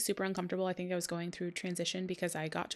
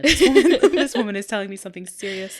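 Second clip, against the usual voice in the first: louder, quick laughing realisation; 13 dB louder, faster than usual. Real, not acted. Telling her friend she realized it was serious, laughing. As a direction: laugh while telling it fast.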